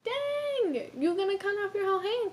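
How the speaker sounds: high, fast, alarmed; shocked kid voice. Retold or imitated. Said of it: "And I'm like".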